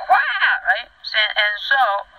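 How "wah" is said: high, loud kung fu cry; one sustained high-pitched "wah"; Bruce Lee scream. Retold or imitated. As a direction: imitate a martial arts battle yelp. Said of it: "I was screaming like a Bruce Lee".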